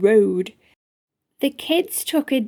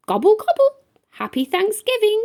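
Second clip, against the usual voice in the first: high, beaming turkey voice; grinning turkey; 10 semitones higher, much faster than usual. A narrator's reading. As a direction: gobble it out with a big grin. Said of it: "replied Toby with a smile non-stop".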